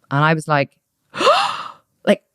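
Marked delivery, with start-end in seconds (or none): 1.1-1.7 high, sharp gasp of disbelief; shocked gasp. Retold or imitated. Client hearing yoga is at stake, shocked gasp. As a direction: gasp as if scandalised.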